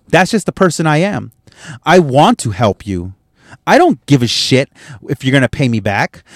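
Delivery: ordinary delivery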